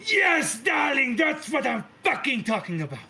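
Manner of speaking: Mother crowing in triumph, arms to the sky; triumphant shout. Acted. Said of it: "jumps up on her desk ... victory pose".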